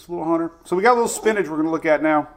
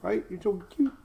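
soft cooing; 4 semitones higher, 8 dB quieter than usual. Real, not acted. Owner coos to his dog on stream, soft cooing. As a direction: coo it to the puppy.

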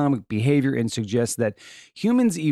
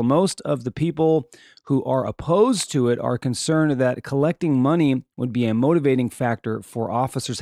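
ordinary talk

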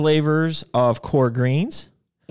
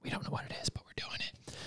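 hushed, conspiratorial whisper; secretive whisper; 18 dB quieter, faster than usual. Pretend. Co-host whispers about secret giveaways to viewers, secretive whisper.

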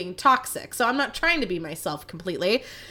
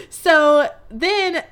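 laughing; 5 semitones higher, 6 dB louder than usual. Real, not acted. Talking through a laugh.

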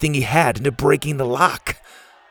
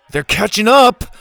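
high, loud urgent warning; alarmed warning; 10 semitones higher, 7 dB louder than usual. Acted. Ash looking back at charging warriors, alarmed warning. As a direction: warn them danger is closing in.

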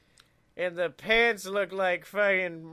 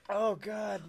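usual speaking voice

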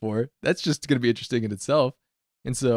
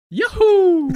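very high, loud cartoon whoop; Charles Martinet's Mario; 8 dB louder than usual. Imitating a voice actor alone at his booth, high Mario whoop. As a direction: whoop it out in a high game-mascot falsetto. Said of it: "he would only use that voice".

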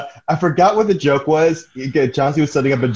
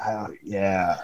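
sarcastic oh yeah; 7 semitones lower, 10 dB quieter than usual. Retold or imitated. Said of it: "I'm like".

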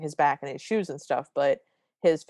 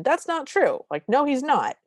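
higher, louder indignant protest; mock angry retort; 8 semitones higher, 4 dB louder than usual. Daughter snapping back at her mother from the kitchen, mock angry. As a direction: snap back in mock outrage. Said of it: "responds very angrily from the kitchen".

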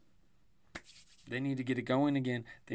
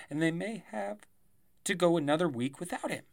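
ordinary talk, nothing marked

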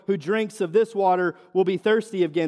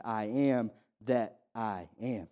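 much softer, lower and slow; hushed reverent repeat; 10 semitones lower, 10 dB quieter, slower than usual. Acted. Preacher repeating Jesus' words to the congregation, hushed and slow. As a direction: let it sink in, barely above a hush.

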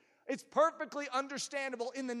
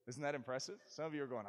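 ordinary talk, nothing marked

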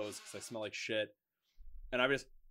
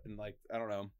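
ordinary talk

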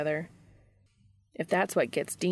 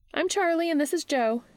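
much higher-pitched character voice; Charlie; 11 semitones higher than usual. A narrator's reading. Charlie introduces herself and Joe to Jimmy, high character voice. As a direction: lift the voice high for a friendly introduction.

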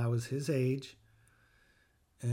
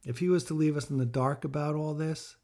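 ordinary delivery

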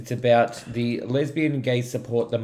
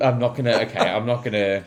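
ordinary delivery

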